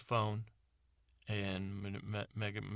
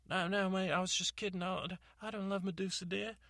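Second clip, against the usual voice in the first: flustered high voice; 9 semitones higher than usual. Acted. Character flustered denial.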